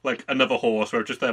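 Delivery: ordinary delivery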